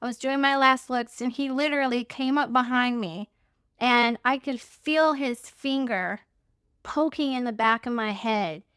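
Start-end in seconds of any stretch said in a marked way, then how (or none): none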